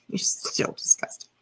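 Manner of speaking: whispered through a laugh, teasing. Real, not acted. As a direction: whisper it with a giggle, mocking.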